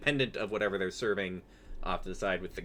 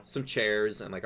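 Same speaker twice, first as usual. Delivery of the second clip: ordinary talk, nothing marked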